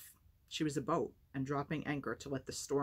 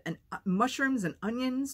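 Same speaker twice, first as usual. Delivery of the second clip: gleeful; 10 semitones higher, 7 dB louder than usual. Acted. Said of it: "He was trying not to smile".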